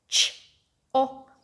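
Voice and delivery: "Ch-op" broken into separate sounds; sounded out. Shown as a demonstration. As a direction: pause between "ch", "o" and "p". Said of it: "do it with your sound buttons".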